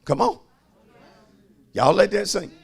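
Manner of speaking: ordinary delivery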